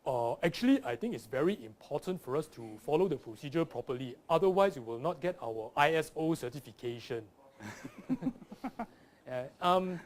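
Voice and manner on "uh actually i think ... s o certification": boardroom Singaporean English, earnest and formal; measured Singaporean corporate-speak; corporate Singaporean accent. Acted. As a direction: play an office executive with a Singaporean accent. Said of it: "They sound like this".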